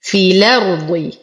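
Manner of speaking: slow في الأرض run together as "fi-lard"; dropped madd. Shown as a demonstration. Teacher reciting Warsh style, في الأرض joined without hamza. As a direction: shorten the i of في الأرض into the lam. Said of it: "يسقط حرف المد بسبب التقاء".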